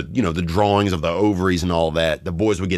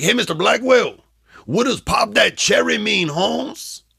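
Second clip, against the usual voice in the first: put-on much higher Mexican-accented question; Mexican accent impression; 13 semitones higher, slower than usual. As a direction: ask innocently in a thick Mexican accent. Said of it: "This is how he sounded".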